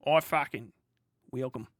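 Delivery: put-on Australian accent. Imagined soldier meeting the shotgun, in an Australian accent. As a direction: lightly imitate an Australian accent. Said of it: "I did an Australian accent".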